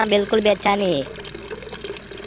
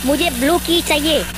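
rising, higher and higher hurry-up calls; frantic urging; 6 semitones higher than usual. Real, not acted. Gamer frantically urging his friend to hurry. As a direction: push your friend faster and faster.

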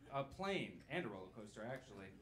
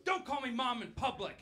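mom scolding; 8 semitones higher, 6 dB louder than usual. Retold or imitated. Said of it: "she goes".

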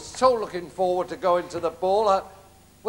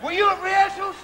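much higher, screeching panto dame voice; shrill panto ugly sister; 11 semitones higher, 4 dB louder than usual. Acted. Ugly sister orders Cinderella to tear the ticket, in a high screeching falsetto. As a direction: lord it over Cinderella in a piercing falsetto.

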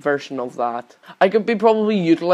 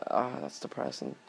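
whispered dejection; 4 semitones lower, 18 dB quieter than usual. Real, not acted. Deflated after crash.

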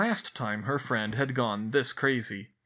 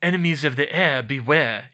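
louder gleeful taunt, leaning on heir; evil gloating; 8 dB louder than usual. A narrator's reading. Draco taunts Hermione before the crowd, louder gloating. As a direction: crow it at Hermione with relish. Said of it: "grinned evilly".

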